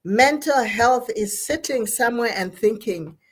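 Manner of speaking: ordinary talk, nothing marked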